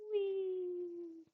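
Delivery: high, gleeful whee; playful whee. Real, not acted. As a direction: sing it out happily. Playful exclamation while drawing.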